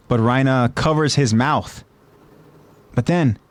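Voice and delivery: ordinary delivery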